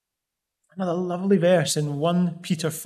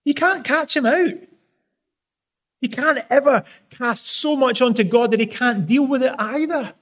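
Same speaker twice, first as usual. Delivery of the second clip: raised-pitch urgent preaching; impassioned preaching; 6 semitones higher, 3 dB louder than usual. Acted. Preacher urging listeners to trust, impassioned. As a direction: press the point home to the congregation.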